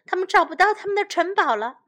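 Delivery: ordinary delivery, nothing marked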